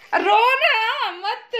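high, slow, half laughing half crying; laughing plea. Real, not acted. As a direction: stretch it out through laughter and tears. Comforting crying child. (हँसी और रोने के बीच धीमी ऊँची आवाज़)

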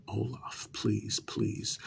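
ordinary talk

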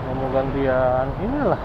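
ordinary delivery

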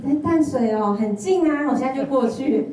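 put-on light up like the boyfriend just called; eager and excited. Boyfriend calling, eager reply.

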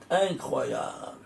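a slow hushed whisper of wonder; awed whisper. Real, not acted. Teacher marvelling to students at the Malchut, hushed slow whisper. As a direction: breathe it out in amazement.

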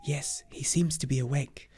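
hushed whisper at the tent door. Acted. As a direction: breathe it so as not to wake him.

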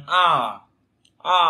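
"à" drawn out with mouth opened; sustained open vowel on "à". Shown as a demonstration. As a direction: open the mouth and let "à" sound. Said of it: "ta chỉ mở khẩu hình là".

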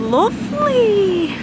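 high, slow, gushing "lovely"; delighted. Real, not acted. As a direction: swoon over the garden. Admiring the president's garden.

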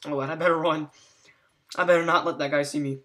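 ordinary talk

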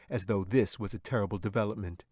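usual speaking voice

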